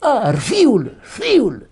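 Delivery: father pleased by his new name, saying it fondly; fond pet name. Retold or imitated. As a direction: savor the nickname like a proud old father. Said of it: "Il m'a dit".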